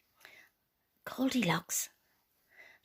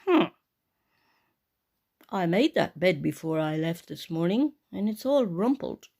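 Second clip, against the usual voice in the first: lightly deepened papa bear voice; lightly grumbling father bear; Daddy Bear voice; 5 semitones lower, 5 dB louder than usual. Acted. Daddy Bear sees his rumpled bed, slightly gruff.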